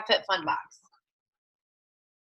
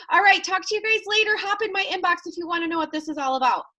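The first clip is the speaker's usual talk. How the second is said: higher, fast, chirpy goodbye; bubbly sign-off; 6 semitones higher, faster than usual. Acted. New recruit signing off her live video, perky and fast. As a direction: wrap it up quick and perky. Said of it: "And Molly's like".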